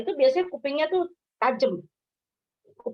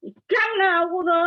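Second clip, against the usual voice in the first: higher, mock irritated snap back; annoyed retort; 7 semitones higher than usual. Bartimeus snapping at the angry crowd, mock irritation. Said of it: "Dia nggak gini".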